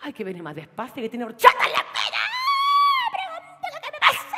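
high, loud furious rant, near shouting; a crying, screaming jealous outburst; jealous fury. Acted. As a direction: rage at him for being late. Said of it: "se encontró con Antoñita".

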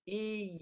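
long "I" closed off as a tight y; stopped ya. Shown as a demonstration. Teacher shows how silent ya sounds, closed y. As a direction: end "I" with mid-tongue pressed up. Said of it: "Bunyi dia mati macam tu".

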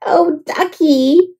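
slow, high "Oh, ducky!"; cried out. A narrator's reading. James cries out at the croaking duck, slow and high. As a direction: stretch out the cry of delight. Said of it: "cried James".

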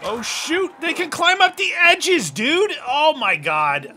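sudden high alarmed yell; alarmed shout. Real, not acted. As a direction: shout in alarm as they climb up. Zombies climb the edges suddenly.

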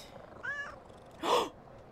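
big, high gasp; excited gasp. Real, not acted. Player discovering a new cat trick, excited gasp. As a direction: suck in air with delight.

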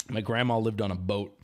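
ordinary talk

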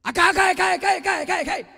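high, loud calling out to a woman ahead. Acted. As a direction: shout after her to make her stop. Calling out to sister. (దూరంగా ఉన్న అక్కను గట్టిగా పిలవడం)

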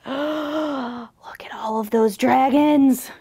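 slow, breathy hush; hushed alarm, drawn out; awed whisper. A narrator's reading. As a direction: whisper in storybook dread. Awestruck at looming dragons.

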